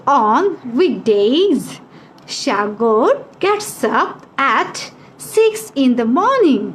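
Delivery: very slow, broken into small chunks; teacher's model reading. Shown as a demonstration. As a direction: stretch each chunk and pause for pupils to follow. Models sentence for pupils to repeat. (খুব ধীরে, থেমে থেমে)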